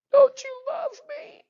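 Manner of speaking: very high, slow, whiny plea; mock a kid guilt-tripping a parent; drawn-out squeaky little-kid whine; pleading child. Child pleading against parent's refusal.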